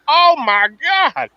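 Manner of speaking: high stunned oh my God; amazed. Retold or imitated. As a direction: gasp it out in awe. Retold amazed reaction.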